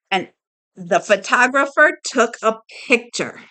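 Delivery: plain reading voice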